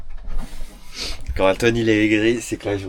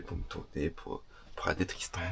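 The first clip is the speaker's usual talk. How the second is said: chuchoté; 8 semitones lower, 22 dB quieter than usual. Real, not acted. Chuchote la nuit près de Tristan.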